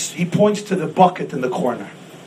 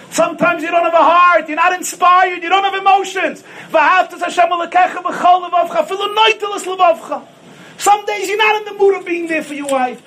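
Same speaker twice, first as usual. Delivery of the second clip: preaching at full force; 10 semitones higher than usual. Acted. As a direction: pound it out to the congregation.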